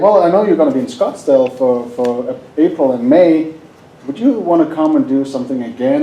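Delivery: ordinary delivery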